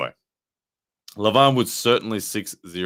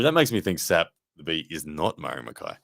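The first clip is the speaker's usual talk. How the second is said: normal talking voice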